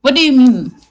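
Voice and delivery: ordinary talk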